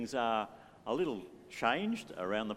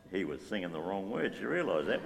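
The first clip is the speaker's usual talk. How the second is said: low, softer mock-confiding aside; mock gossipy whisper; 7 semitones lower, 6 dB quieter, faster than usual. Churchgoer gossiping about someone's wrong words, in a mock hushed aside. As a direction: pretend to gossip under your breath. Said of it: "We're not gonna whisper about you".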